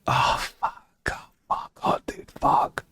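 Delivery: anguished whispered curses; whispered dismay. Retold or imitated. Hearing a hurtful remark at work, whispered anguish. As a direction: breathe it out, crushed.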